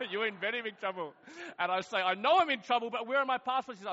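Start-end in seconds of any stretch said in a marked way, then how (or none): 0.1-1.1 put-on Indian accent. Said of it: "Indian man". 2.0-3.8 loud, high re-enacted frustration; exasperated retort. Frantic retort to post office man.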